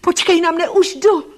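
high, loud, urgent shout; crying out. A narrator's reading. She begs the voice to wait, high urgent cry. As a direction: call out pleadingly at a high pitch. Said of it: "vykřikla jsem".

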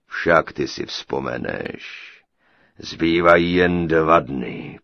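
low, slow, loud giant voice; booming giant. A narrator's reading. Giant greeting his captive bride, low slow booming. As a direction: thunder it out like a huge giant.

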